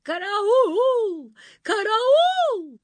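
high, very loud drawn-out yell; frantic wail. A narrator's reading. Nanny cries for help from the palace, frantic wailing. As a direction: scream for the guards at full voice. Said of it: "истошные вопли нянюшки-матушки".

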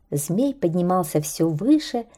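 plain reading voice

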